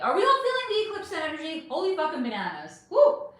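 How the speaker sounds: rising hype, voice leaping up; excited. Real, not acted. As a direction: get the chat pumped up. Hyping eclipse energy to viewers.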